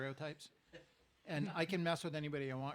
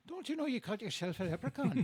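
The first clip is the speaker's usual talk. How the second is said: high-pitched Irish leprechaun voice; 8 semitones higher, 4 dB louder than usual. Acted. Leprechaun scolding the golfer, high Irish lilt. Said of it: "I also do Irish".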